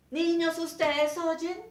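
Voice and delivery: teacher asks the pupils, loud and slow; teacher calling out. Retold or imitated. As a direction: call out to the whole class. Teacher appealing to the class.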